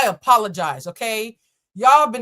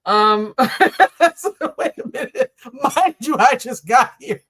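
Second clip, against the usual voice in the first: high, helpless giggling through words; laughing; 5 semitones higher, 6 dB louder than usual. Real, not acted. Reader losing it at the Aquarius cards, real laughing. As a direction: struggle to talk while cracking up. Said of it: "The Gemini in me is laughing".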